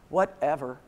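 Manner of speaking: huff, then a low, dismissive mutter; mock a sulky teen brushing mom off; huffy teenager. Teen daughter told to clean the kitchen, huffy. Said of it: "one of those little huffy breaths".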